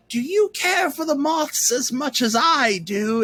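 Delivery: high, slow put-on old lady voice; fake old voice. Mom's friend playing a character, fake old voice. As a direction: quaver it out like a dotty old woman. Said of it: "In this kind of fake old voice".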